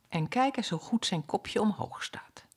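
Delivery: whispered fondly, no voice; whispering mother duck; tender whisper. Acted. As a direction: hush it like a secret. Mother duck admiring her chick.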